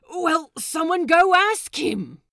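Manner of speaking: high, loud fed-up cry; exasperated shout. Acted. Crewmate yelling at the group to go ask Buttons, fed up. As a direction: bark it at the whole crew.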